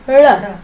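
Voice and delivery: "R" as an L with tongue back and up; retroflex L. Shown as a demonstration. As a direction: curl the tongue so this L almost sounds like "R". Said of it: "your tongue's going back and up".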